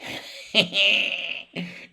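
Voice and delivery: high hee-hee cackle; gleeful cackle. Real, not acted. Cook delighted that his duck is done, gleeful cackle.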